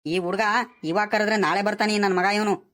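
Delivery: ordinary delivery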